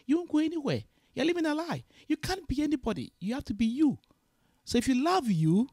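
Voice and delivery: high and loud, preaching self-love; impassioned exhortation. Real, not acted. Impassioned motivational speech.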